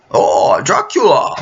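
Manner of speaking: mock Transylvanian accent, the name wailed out with a cry. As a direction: cry the name out like a hammed-up vampire. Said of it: "Count Dracula's accent".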